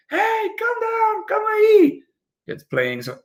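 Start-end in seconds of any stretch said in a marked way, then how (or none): 0.1-2.0 very high-pitched calling out, held back in volume; mock shouting. Parent calling the kids down to eat, mock shouting. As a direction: call from afar in a high, drawn-out mock shout. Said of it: "like, shouting".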